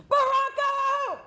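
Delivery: long, high drill sergeant scream. Retold or imitated. Drill sergeant calls recruit up the hill, screaming. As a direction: scream the name up the hill, drawn out. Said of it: "screaming like a motherfucker".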